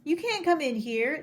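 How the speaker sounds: put-on a girl shouting as a moose walks in; girl crying out. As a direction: cry out to stop him entering. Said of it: "A girl cried".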